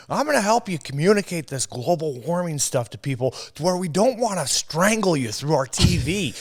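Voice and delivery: hammed-up earnest show host; eager TV host. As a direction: play a pushy host offering help. Acting out the sketch host.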